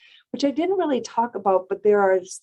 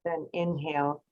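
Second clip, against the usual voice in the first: guided breathing count. Shown as a demonstration. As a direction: count them through the breath gently. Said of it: "I'll just be the counter".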